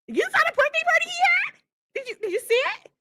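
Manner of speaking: very high, squeaky put-on voice; high, stretched mock pleading; mocking imitation. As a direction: mimic her in an exaggerated high pitch. Still mimicking the rival's talk.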